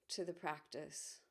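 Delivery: calm, measured guiding voice with long pauses; guided meditation. Acted. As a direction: ease listeners gently inward, leaving space between phrases.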